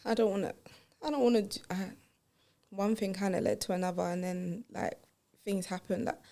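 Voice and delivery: quiet, hesitant confession; a guilty, hushed admission. Acted. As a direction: murmur it, scared to say it.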